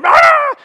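high, loud wail of a hungry newborn; mock baby wail. Preacher imitating a hungry newborn, loud high wail. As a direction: wail like a baby screaming for milk. Baby crying while bottle is filled.

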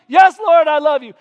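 high, loud earnest answer; fervent reply. Retold or imitated. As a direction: cry out your love. Peter answers again.

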